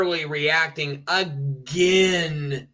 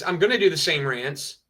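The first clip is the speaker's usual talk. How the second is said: usual speaking voice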